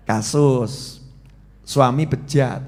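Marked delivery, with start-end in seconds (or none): none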